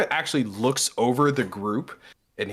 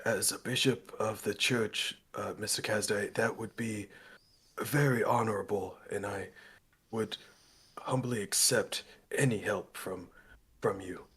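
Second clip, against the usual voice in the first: soft, measured formal gratitude; respectful, humble; 6 dB quieter than usual. Acted. Abernathy bows to the bishop who offers help, humbly respectful. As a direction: accept humbly, with courtly pauses. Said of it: "he bows very respectfully to you".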